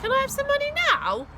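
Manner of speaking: high, loud, fast pleading; eager kid. A narrator's reading. Boy eagerly asks mum for money up front. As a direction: blurt it out hopefully.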